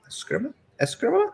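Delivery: Eskrima with a high, rising Spanish accent. Shown as a demonstration. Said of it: "I say it with a Spanish accent".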